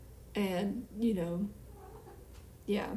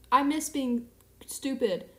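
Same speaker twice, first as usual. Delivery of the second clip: normal talking voice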